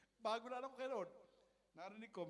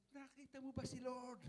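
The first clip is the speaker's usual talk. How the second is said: excited question; 7 semitones higher than usual. Retold or imitated. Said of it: "Ako excited".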